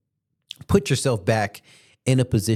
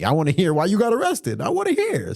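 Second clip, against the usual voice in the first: eager; 10 semitones higher than usual. Retold or imitated. Eager to hear the story.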